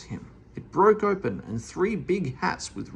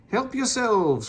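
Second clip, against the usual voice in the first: cried out; 9 semitones higher, 3 dB louder, slower than usual. A narrator's reading. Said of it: "cried Meddle".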